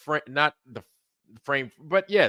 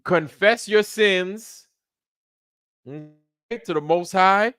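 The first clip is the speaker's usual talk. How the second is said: preachy plea; 6 semitones higher, 4 dB louder, slower than usual. Real, not acted. Preaching at the viewer.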